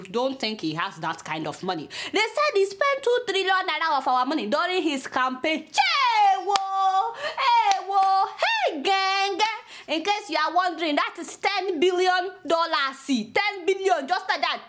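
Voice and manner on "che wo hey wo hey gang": high, louder comic crying wail; mock wailing. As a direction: lament the stolen billions. Lament over stolen money.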